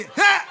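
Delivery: sudden high, loud "Hah!"; explosive mock kung fu yell; imitated fighter's shout. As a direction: bark out a martial-arts cry. Imitated silat film fighter shout.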